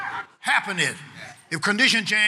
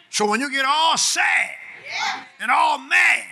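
raised voice, drawn-out punched key words; forceful preaching; 7 semitones higher than usual. Real, not acted. Preacher warning the congregation about losing strength, forceful preaching. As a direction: hammer the warning home to the church.

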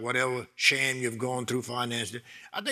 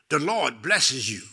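ordinary talk

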